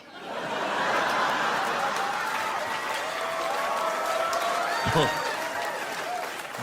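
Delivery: breathless high wheezing laugh. Real, not acted. Audience cracking up, a long breathless wheezing laugh.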